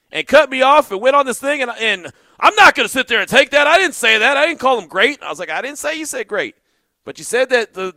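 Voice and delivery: high, loud, worked-up storytelling; heated retelling. Real, not acted. Telling viewers about the host's outburst, heated and loud. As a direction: get fired up reliving the argument.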